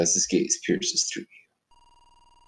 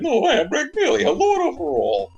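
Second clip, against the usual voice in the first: booming frost giant; 5 dB louder than usual. Acted. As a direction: gloat like a grand evil lord. Villain Magmelia speaks.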